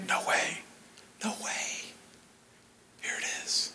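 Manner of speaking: breathless whisper of disbelief; a boy whispers over his Christmas gift; awed whisper. Retold or imitated. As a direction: breathe it out in wonder. Unwrapping dream gift, awed.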